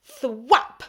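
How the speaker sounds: loud, high smack effect; a splat of something wet; loud slap sound. A narrator's reading. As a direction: punch out the impact noise.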